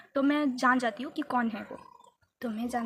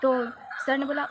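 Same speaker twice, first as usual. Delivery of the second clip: ordinary talk, nothing marked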